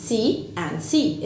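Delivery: "see and see" said very slowly; slow demo. Shown as a demonstration. Teacher modelling see and sea for kids. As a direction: stretch each "see" evenly. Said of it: "بحر".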